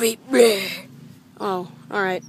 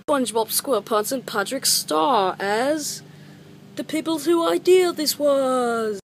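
movie credits announcer; 5 semitones higher than usual. Acted. Kid reading the cast list, announcer voice. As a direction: announce each name like closing credits.

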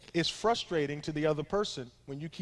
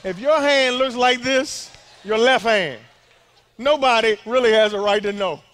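loud sermon voice; full-force preaching; 3 semitones higher, 8 dB louder than usual. Real, not acted. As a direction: preach it hard.

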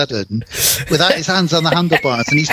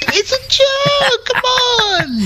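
very high, drawn-out mock protest; hammed-up high-pitched pleading; whiny protest; much slower than usual. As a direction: squeal it slowly in falsetto, half laughing. Retelling slapped man's whiny protest.

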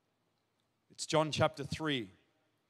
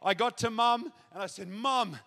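loud, higher-pitched testimony, ringing out; impassioned preaching; 5 semitones higher, 6 dB louder than usual. Real, not acted. Preacher tells the crowd who got saved, impassioned preaching. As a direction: preach it with mounting excitement.

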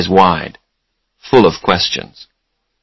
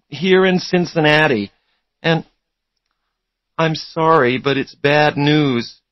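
put-on higher-pitched girl caller; girl's voice; 6 semitones higher than usual. A narrator's reading. Lawyer's caller breaking news of a death, girl's voice. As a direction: give the news in a high girl's voice. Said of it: "It was a girl's voice".

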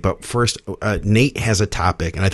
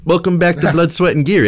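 a light, higher-pitched Canadian lilt; Canadian accent; 8 semitones higher, 3 dB louder than usual. Shown as a demonstration. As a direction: play it up as a Canadian, eh-style. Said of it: "Can you do it in a Canadian accent, like a boot?".

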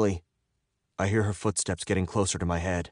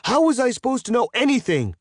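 high, loud, outraged yell; shouting; 5 dB louder than usual. A narrator's reading. Captive arguing with his captor, furious shouting. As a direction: keep yelling, pitch right up. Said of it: "I shout".

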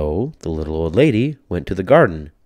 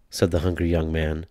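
plain reading voice

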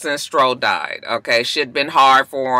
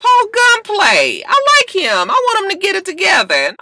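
mock wistful; 4 dB louder than usual. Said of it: "Kiara walk out like".

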